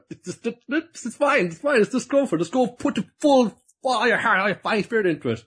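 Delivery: high, breezy brush-off; mock breezy chef. Chef waving off his apprentice's question, high and eager. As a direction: wave it away in a high, eager voice.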